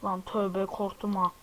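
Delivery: ordinary talk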